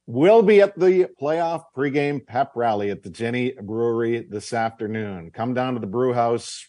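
ordinary talk, nothing marked

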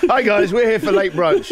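high, hammed-up greeting through a laugh; cheesy diner greeting; mock cheerful arrival. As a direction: play the chirpy diner announcing himself.

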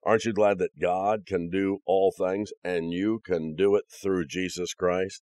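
ordinary talk

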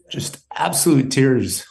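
slow, voice catching with real emotion; fighting back tears as he speaks; choked up. Real, not acted. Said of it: "I'm getting a little choked up".

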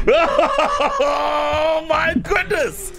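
high, slow laughing exclaim. Real, not acted. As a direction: cry out while laughing. Host reacts to prank.

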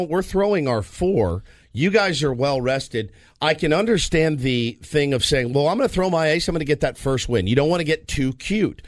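usual speaking voice

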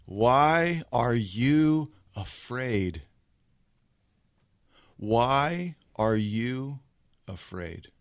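very slow, stretched-out question; drawn-out challenge. Acted. Jesus just woken, facing his disciples, slow drawn-out challenge. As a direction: draw out WHY and YOU, let AFRAID drop. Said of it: "looks him in the face and says this".

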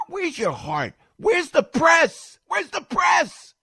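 high, loud, drawn-out shouting; outraged yell. Real, not acted. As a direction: yell the demand slowly at full voice. Outraged rant demanding media attention.

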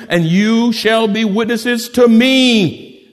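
slow and emphatic, drawn out; slow preached scripture. Acted. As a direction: preach it word by word.

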